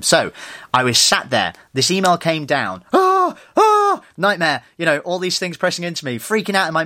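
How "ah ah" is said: panicked gasps. Retold or imitated. Panic as the refund email lands.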